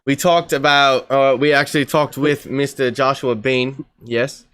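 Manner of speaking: put-on English accent, carrying on regardless; mock-English podcast patter. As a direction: press on in a fake English accent. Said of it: "you're from the U K".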